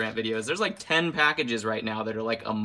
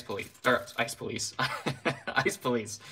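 laughing; 4 dB quieter than usual. Real, not acted. Laughing at own slip.

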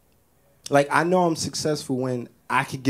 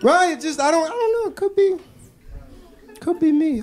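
squeaky. Real, not acted. Musing to viewers, squeaky high. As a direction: go way up in pitch.

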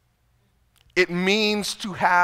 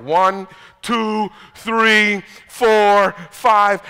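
loud counting; 3 semitones higher, 8 dB louder, much slower than usual. Retold or imitated. Father counting to calm himself, loud and firm. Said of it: "I'm like".

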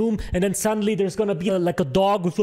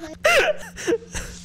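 high shrieking laugh. Real, not acted. Gamer cracking up at his own joke, high laugh. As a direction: burst of high-pitched laughter.

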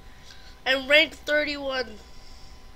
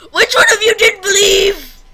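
high, loud accusing wail; loud, high-pitched cry of blame; anguished shout; 8 semitones higher, 15 dB louder than usual. Real, not acted. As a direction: yell it at the audience in outrage. Has just died in game.

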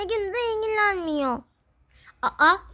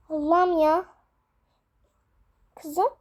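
ordinary talk

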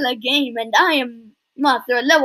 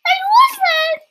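high squealing disbelief; shocked; 3 dB louder, slower than usual. Real, not acted.

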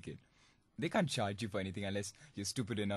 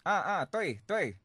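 higher, louder put-on voice answering in Chinese; mock Chinese caller reply; 8 semitones higher, 6 dB louder, slower than usual. Prankster answering a wrong-number caller, put-on high voice. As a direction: pretend to be the friend he wanted, high and loud. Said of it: "I think, I go".

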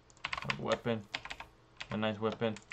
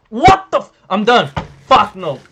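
very loud, high outburst; frustrated shouting; 9 semitones higher, 16 dB louder than usual. Real, not acted. Player quitting after dying in game, frustrated shouting. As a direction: explode in real exasperation. Gamer gives up after sudden death.

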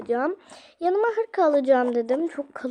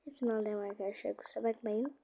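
much softer, quick apology; sheepish aside; 3 semitones lower, 9 dB quieter, faster than usual. Real, not acted. To viewers about taking all dyes, soft sheepish aside.

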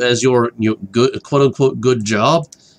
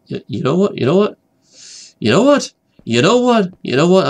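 excited; 7 semitones higher than usual. Real, not acted. Building up to a decision.

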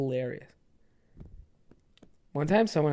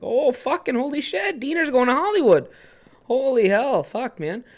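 high, jeering hoser voice; mocking local dude; 9 semitones higher than usual. Townie mocks Diener going to Hollywood, jeering high. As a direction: razz the kid like a rowdy townie. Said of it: "I'd be like".

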